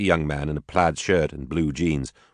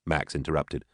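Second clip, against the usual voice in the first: plain reading voice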